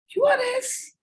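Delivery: very high, questioning letters; asking letters. Shown as a demonstration. Teacher showing the class how a question mark sounds, very high. Alphabet read with punctuation expression.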